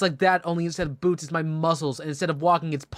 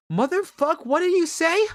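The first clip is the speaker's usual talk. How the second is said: very high, incredulous mock-angry outburst; hammed-up outrage; 13 semitones higher, 3 dB louder than usual. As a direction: shriek the challenge theatrically. Drunk man confronts the offender.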